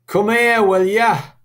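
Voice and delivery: high, loud northern regional accent. Shown as a demonstration. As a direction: call it out in a broad regional accent, dropping the H. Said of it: "this is a regional accent".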